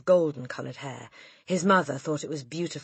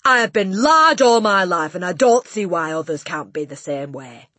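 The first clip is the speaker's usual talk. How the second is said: snapping Trunchbull; 3 semitones higher, 13 dB louder than usual. A narrator's reading. Headmistress putting a pupil right, indignant and loud. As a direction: declare it loudly and crossly. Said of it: "she snapped".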